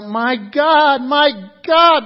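loud, high anguished cry. Acted. Jesus on the cross calling to God, loud and high. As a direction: wail it up to heaven. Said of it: "he cries out".

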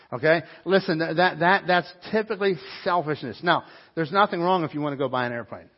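emphatic preaching. Acted. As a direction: hammer the point home.

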